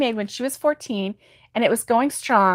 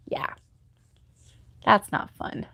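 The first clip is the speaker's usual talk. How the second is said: usual speaking voice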